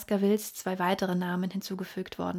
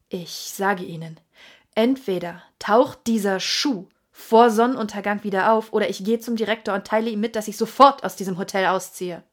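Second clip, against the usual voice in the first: loud, rising rant, faster at the end; furious; 8 dB louder than usual. A narrator's reading. Sir Henry shouting at the hotel waiter, furious. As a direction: demand answers, shouting. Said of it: "Er war dermaßen wütend".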